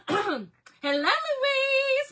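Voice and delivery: high, loud, sing-out hello; gleeful greeting. Real, not acted. Vlogger greeting a friend who joins the livestream, high and gleeful. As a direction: call out the name high and delighted.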